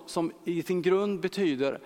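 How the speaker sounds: ordinary talk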